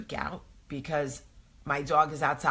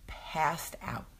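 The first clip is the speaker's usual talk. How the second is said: sleepy whisper; 5 dB quieter than usual. Retold or imitated. Falling asleep after turning the phone off, whispered. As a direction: drop to a whisper as if nodding off.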